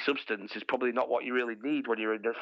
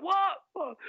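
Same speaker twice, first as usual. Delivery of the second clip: hammed-up baffled shout; mock surprise. Boyfriend confused by her outburst, mock surprise. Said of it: "I'm like".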